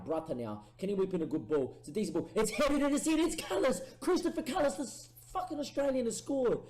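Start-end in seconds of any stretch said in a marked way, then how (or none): 2.3-6.6 high, loud commentator-style goal scream; excited goal call. Real, not acted. Gamer commentating his team's goal, ecstatic shout. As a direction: erupt as the header goes in.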